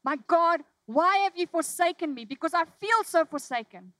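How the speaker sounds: loud, high cry of anguish to God; crying out forsaken. Pretend. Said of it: "with respect say to him".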